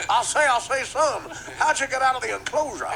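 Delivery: high, loud cartoon rooster bluster; hammed-up preacher-rooster voice; Sharpton Leghorn. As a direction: boom it out like a blustering cartoon bird. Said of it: "Sharpton Leghorn".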